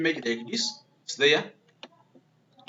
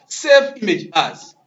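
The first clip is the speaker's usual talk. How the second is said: "Save image as" slow and loud; "Save image as", stretched out and punched; emphatic dictation; 3 semitones higher, 7 dB louder, slower than usual. Shown as a demonstration. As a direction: hammer home "Save image as" for learners. Teacher repeating the menu command.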